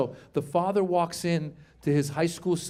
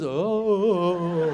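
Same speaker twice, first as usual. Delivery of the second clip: imitation of a dazed son; long vacant "oh", drifting off; spaced-out boy. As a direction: play a spaced-out teenage boy. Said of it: "boys get a little spaced out".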